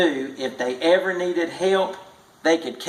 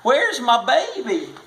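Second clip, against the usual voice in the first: higher pleading cry; frantic mother; 9 semitones higher than usual. A narrator's reading. Mother begging Mose for her baby, higher frantic cry. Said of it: "the mother cried".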